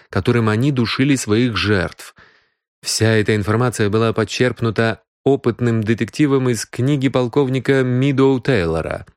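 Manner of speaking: ordinary delivery, nothing marked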